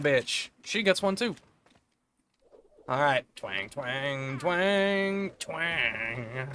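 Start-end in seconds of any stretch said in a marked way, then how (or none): 3.4-6.5 drawn-out ringing twangs, up then down; imitated twang. Streamer showing off the power of the twang, imitated twang. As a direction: stretch each twang like a plucked string. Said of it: "The power of the twang".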